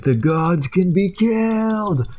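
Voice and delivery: slow, high dramatic proclamation; epic declaration. Retold or imitated. Hot dog holding the human head, slow and epic. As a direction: announce it like a war hero.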